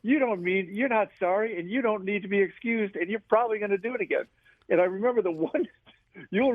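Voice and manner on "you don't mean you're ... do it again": higher, fast, exasperated retort; mock exasperation. Players grumbling at Seve, a hint of exasperation. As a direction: fire back like you're fed up.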